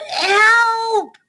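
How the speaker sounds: high, loud, drawn-out yell; cry for help. Acted. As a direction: yell for help as you go tumbling. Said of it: "he cried".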